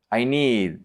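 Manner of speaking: usual speaking voice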